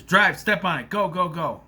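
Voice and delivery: loud, urgent command to drive; urgent order. Retold or imitated. John ordering her to flee after shooting, loud urgent command. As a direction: hurry her away in a loud burst.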